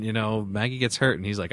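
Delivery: normal talking voice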